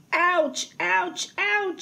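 high little yelps, climbing in pitch; hammed-up hurt from knocks on the head; pained moan. A narrator's reading. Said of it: "she moaned".

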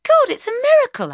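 very high, loud cry of amazement; excited exclamation. A narrator's reading. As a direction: exclaim it in high-pitched awe. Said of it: "I exclaimed".

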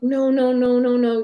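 mock-urgent protest, cutting her off; emphatic protest. Grieving client retold reassuring the medium, loud insistent protest.

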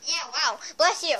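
ordinary talk, nothing marked

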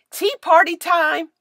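delighted. A narrator's reading. Maddie delighted with hat.

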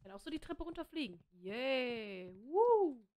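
ordinary talk, nothing marked

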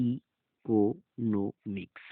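ইকোনমিক্স very slowly, each syllable apart with pauses. Shown as a demonstration. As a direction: separate the opening ই and every syllable of ইকোনমিক্স. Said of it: "সম্পূর্ণ পৃথকভাবে হয়েছে". (ইকোনমিক্স শব্দটি খুব ধীরে, ভেঙে ভেঙে)

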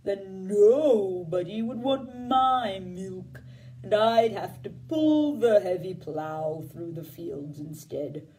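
low, slow, lumbering cow. Acted. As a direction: speak like a worried old cow, dragging each word. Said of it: "asked the cow".

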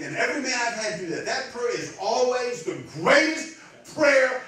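loud, high, soaring sermon delivery; full-force preaching. Real, not acted. Preacher proclaiming a desperate man's prayer, full-force preaching. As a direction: build to a shouted climax, stretching key words.

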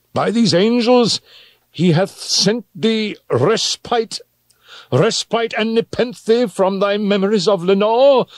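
high, impassioned outcry; anguished theatrical cry. A narrator's reading. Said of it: "I cried".

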